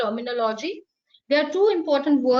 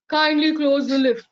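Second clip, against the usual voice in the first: a hint of a recorded machine voice, a bit louder; mock the annoying automated lift voice; lightly imitated lift announcement; 4 dB louder than usual. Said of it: "Is that voice very pleasant?".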